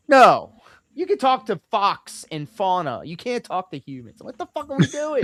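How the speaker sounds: high-pitched incredulous mocking; mock outrage. Co-host mocking a streamer's talk of other humans, incredulous.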